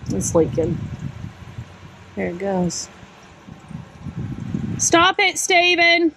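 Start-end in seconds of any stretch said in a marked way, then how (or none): 4.8-6.1 raised, higher call to Steven. Real, not acted. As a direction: call out to him to stop. Calls out to him across the camper.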